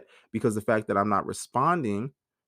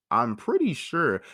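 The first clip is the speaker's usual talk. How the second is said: sarcastic certainty; 6 semitones higher, slower than usual. Retold or imitated. Retorting to partner doubting memory.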